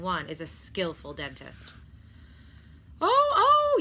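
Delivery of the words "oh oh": very high, loud squealing cry; high frantic squeal; yelled dismay. A narrator's reading. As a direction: squeal in alarm, jumping up and down. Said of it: "yelled Stuart".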